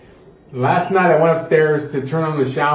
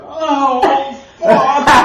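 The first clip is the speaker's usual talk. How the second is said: high laughing yelp of "Oh, fuck"; half-laughing cry of pain; retold yelp; 10 semitones higher, 4 dB louder than usual. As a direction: let the curse burst out through a laugh. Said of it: "I had to let it out".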